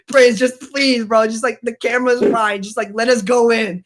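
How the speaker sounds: high, loud retold plea; pleading. Storyteller begging Prince to let it go, pleading. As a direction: beg your friend, exasperated and half laughing. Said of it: "looked like about to cry".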